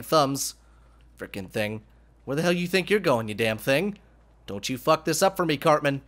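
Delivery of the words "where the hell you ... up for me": ordinary talk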